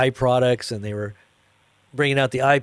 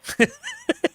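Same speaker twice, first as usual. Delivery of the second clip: very high squeal of laughter; squeaky laugh. Real, not acted. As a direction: break into a high giggle. Laughs at own joke.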